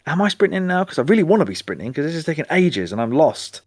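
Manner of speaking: high-pitched, incredulous self-quote; mock exasperation, starting high and easing down; imitated impatient questioning. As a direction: act out doubting, impatient questions. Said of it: "being like".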